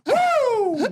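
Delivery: high shouted woo; Flair woo. Retold or imitated. Ric Flair's woo for the podcast crowd, loud and high. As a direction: let out the Nature Boy's signature woo. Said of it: "Ric Flair used to stand there and go".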